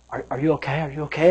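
low, very soft check-in; tentative and hushed; hushed concern. Retold or imitated. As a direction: ask it softly, unsure, with a small stumble. Acts out worried check on girl.

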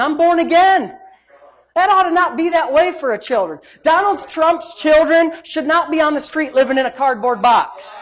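loud, driving pulpit preaching; preaching at full force. Acted. Preacher urging Christians not to live broke, loud sermon. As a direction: hammer it home to the crowd.

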